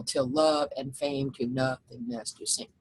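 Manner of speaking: slow, measured poem reading; reading verse aloud. Shown as a demonstration. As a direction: read the last line slowly like verse. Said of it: "Last line, same deal".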